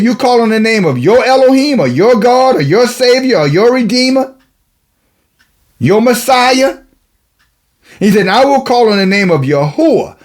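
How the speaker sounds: high, ringing scripture declamation; preaching. Real, not acted.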